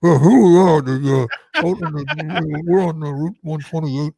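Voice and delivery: mock reporter talking through a mask; muffled by a mask. Shown as a demonstration. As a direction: muffle the words as if the mouth is covered. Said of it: "rather than muffle and go".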